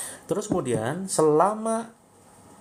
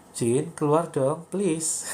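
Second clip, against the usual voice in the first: too gentle; 3 semitones higher, much slower than usual. Shown as a demonstration. Healer mocks the too-gentle way of ordering a jinn out, coaxing. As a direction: ask the jinn nicely, slowly. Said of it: "Nggak gitu ya".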